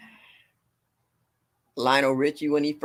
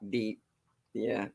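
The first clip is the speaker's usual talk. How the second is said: ordinary talk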